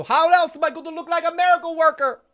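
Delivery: high Scottish engineer voice; Scotty impression. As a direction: put on Scotty's brogue. Voicing Scotty's excuse.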